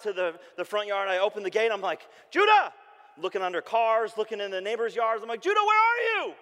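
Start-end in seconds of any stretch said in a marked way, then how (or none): 2.3-2.7 much higher, loud shout; panicked shout. Retold or imitated. Father panicking, shouting for lost son. Frantic search at gate. 5.4-6.3 high, loud panicked yelling; frantic shout. Retold or imitated. Father searching frantically for missing son, shouting.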